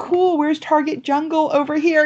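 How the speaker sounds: high, breezy mock-casual; joking. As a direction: play the clueless tourist. Joking retold thought.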